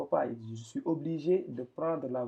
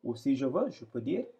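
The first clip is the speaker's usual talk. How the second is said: ordinary delivery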